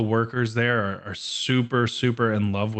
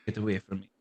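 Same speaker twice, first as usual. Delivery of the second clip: French cat voice; 2 semitones lower, 8 dB quieter, faster than usual. Retold or imitated. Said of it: "She's got a French voice".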